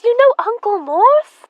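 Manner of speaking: high, loud eager question; delighted. Acted. Emma asking the reporter eagerly about Uncle Morse. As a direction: beam with delight.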